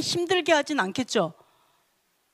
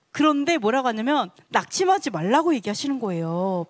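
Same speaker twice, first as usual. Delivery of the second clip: normal talking voice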